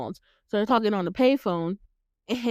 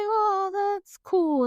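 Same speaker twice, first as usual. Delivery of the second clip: high, drawn-out admiring coo; imitating someone charmed and impressed; 10 semitones higher, slower than usual. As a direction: stretch it out, lightly wowed. Acting out Billy impressed by instrument.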